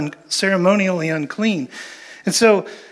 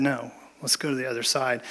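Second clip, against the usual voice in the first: calm quiet insistence; 5 semitones lower, 11 dB quieter than usual. Retold or imitated.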